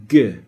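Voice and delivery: G punched loud; a sharp full-contact G; emphatic hard G. Shown as a demonstration. Teacher demonstrating the tongue's full contact on G. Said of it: "the back of your tongue is touching".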